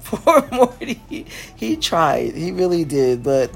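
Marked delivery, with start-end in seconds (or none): 0.0-1.3 laughing. Real, not acted. Laughing pity while retelling.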